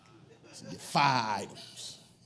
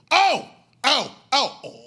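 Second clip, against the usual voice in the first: much higher and louder wailing "oh"; a startled howl of protest; mock outraged cry; 11 semitones higher, 12 dB louder, much slower than usual. Comedian imitating Blade's loud outcry when thrown out. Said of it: "This is what he said".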